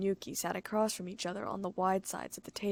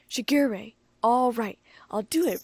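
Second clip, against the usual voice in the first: loud, resolute; determined declaration; 3 semitones higher, 8 dB louder, slower than usual. A narrator's reading. Richon declaring he will face the stalker. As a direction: declare it bravely. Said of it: "Richon declared".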